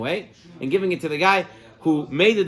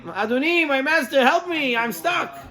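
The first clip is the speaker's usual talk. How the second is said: high, pleading servant stuck on the roof; hammed-up pleading yell, pitched high; mock cry for help; 8 semitones higher than usual. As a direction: call down plaintively from the roof. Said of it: "he screams down".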